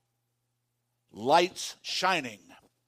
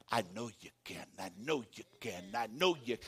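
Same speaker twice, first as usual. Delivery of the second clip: soft, lower encouraging murmur; gentle reassurance; 9 semitones lower, 9 dB quieter than usual. Retold or imitated. Preacher voicing the Spirit encouraging a student, soft and low. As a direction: quietly assure them they can. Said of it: "the Holy Spirit was speaking to you and says".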